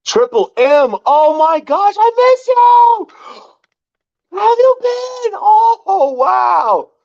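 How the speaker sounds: very high, loud squealing mock reunion; squealing greeting. Greeting Triple M like a long-lost friend, squealing high. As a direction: gush like an overexcited fan greeting a friend.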